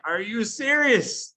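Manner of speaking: slow, high, incredulous question; can't believe the team skips the videos, drawn out; exasperated disbelief. Retold or imitated. Coach to his team, slow exasperated disbelief. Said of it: "I just wanna scream".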